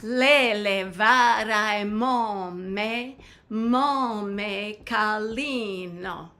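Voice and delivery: slow, drawn-out syllables in song rhythm; spoken in rhythm. Shown as a demonstration. Teacher saying lyrics for learners to repeat, rhythmic speech. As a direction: speak the lyric slowly to the beat, unsung. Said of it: "I'll say it in the rhythm of the song".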